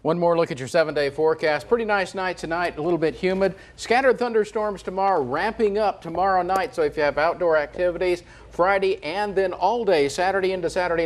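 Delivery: lively weatherman forecast read; walk viewers through the week. Acted. Meteorologist to viewers, upbeat forecast read.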